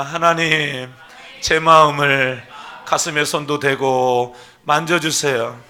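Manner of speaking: loud, slow pleading prayer; fervent prayer. Shown as a demonstration. Pastor leading the church in prayer, fervent loud plea. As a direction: pray it out slowly for all to follow. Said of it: "따라서 한번 하겠습니다".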